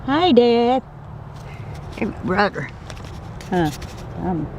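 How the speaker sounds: high, sweet little greeting. Real, not acted. Owner greeting her snakes, high cute coo. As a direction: coo hello like a pet owner.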